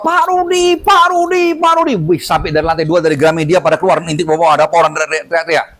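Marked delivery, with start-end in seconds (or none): 0.0-1.9 retold scream. Woman recognizing her healer shrieks his name. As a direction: call out across the mall in joy. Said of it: "sampai lompat-lompat".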